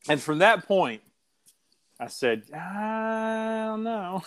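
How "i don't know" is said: high, slow, softer hesitation; drawn-out doubt. Retold or imitated. Host retelling his reaction to the segment, drawn-out doubt.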